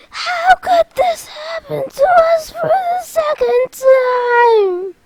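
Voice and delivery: very high, hammed-up shriek; dismayed wail. Vexen defeated by Sora's summons, shrieked dismay. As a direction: screech it in mock despair.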